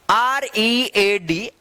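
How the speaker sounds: आर ई ए डी drawn out by letter; slow spelling. Shown as a demonstration. Teacher spells for learners, आर ई ए डी stretched slowly.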